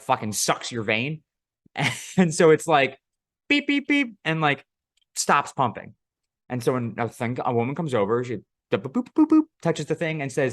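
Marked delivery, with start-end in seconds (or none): 3.5-4.2 high mock beeping; pitched-up electronic beeps; machine beeps. 8.8-9.4 high mock boops; pitched-up button-press sounds.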